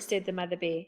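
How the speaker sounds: plain reading voice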